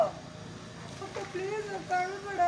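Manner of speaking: soft plea as if crying; acted tearful pleading. Shown as a demonstration. Coach showing how to beg big sister, mock tearful. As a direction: plead softly through pretend tears. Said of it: "ನೀನು ಹತ್ಕೊಂಡೇ ಹೇಳು".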